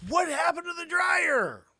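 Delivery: very high, loud slow yell; shout. Retold or imitated. Wife yelling about the missing dryer, loud shout. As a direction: demand an answer.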